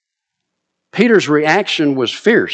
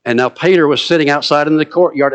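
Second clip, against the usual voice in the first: normal talking voice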